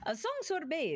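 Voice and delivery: song lifted high with a flourish; grander take. Shown as a demonstration. [name] redoes her line in a grander manner. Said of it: "I should say that in a much more".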